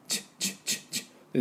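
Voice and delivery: チュ as a voiceless "ch"; short unvoiced "ch" bursts for チュ; CH sound. Shown as a demonstration. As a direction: puff out チュ with no vowel. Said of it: "このチュ".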